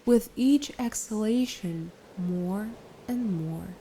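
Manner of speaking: unhurried, soothing instructions, easing off at phrase ends; guided meditation. Acted. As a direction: gently lead the listener into relaxation, letting each phrase settle.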